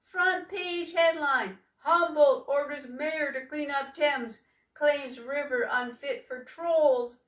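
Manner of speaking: loud, slow announcer parody; mock headline. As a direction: ham it up like a newsreader. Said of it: "Bilius Bargy taunted".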